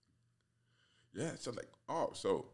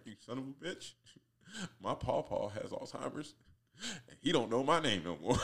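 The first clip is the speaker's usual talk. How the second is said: mock outraged listener; 8 semitones higher, 6 dB louder than usual. Offended listener voiced, angry.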